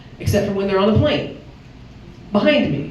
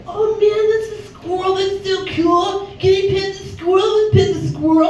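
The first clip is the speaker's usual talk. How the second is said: put-on excited little kid; 11 semitones higher than usual. A little boy begging to pet the squirrel, high and eager. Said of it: "this little kid was like".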